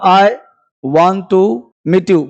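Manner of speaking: ordinary talk, nothing marked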